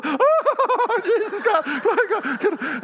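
very high, loud hammed-up ecstatic cries; mock-frenzied squeal, easing down at the end; overexcited interpreter. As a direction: mimic someone carried away, wailing up high. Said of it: "She got so excited, she was going".

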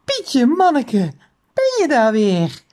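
slow, drawn-out cooing exclamation; delighted little squeal. A narrator's reading. Teacher seeing her bird back, delighted little squeal. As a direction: greet a lost pet with drawn-out delight. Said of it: "slaakte een gilletje".

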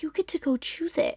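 soft whispered encouragement. Real, not acted. Urging the viewer to choose their life, whispered. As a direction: whisper it like a secret. Closing pep talk to viewers.

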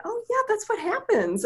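high, singsong unfazed reply; breezy reassurance. Retold or imitated. Healer brushing off client's amazement, breezy. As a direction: imitate someone finding it perfectly normal.

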